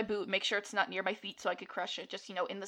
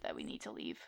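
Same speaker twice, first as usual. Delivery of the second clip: whisper; 13 dB quieter than usual. Real, not acted. Realizes mistake, drops to whisper.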